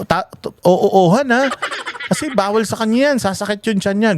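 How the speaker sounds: high-pitched mock scolding of the nurses. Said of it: "nagagalit sa amin".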